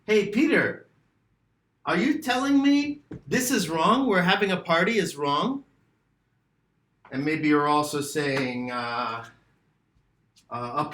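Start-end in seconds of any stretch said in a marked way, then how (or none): 0.0-5.6 puzzled student. Retold or imitated. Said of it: "And you're asking me".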